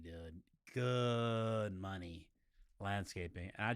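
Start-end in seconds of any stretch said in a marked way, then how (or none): 0.7-2.3 "Good" held very long, then "money" let fall; drawn-out emphasis. Real, not acted. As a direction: stretch "Good" right out, slowly. Repeats the drawn-out praise.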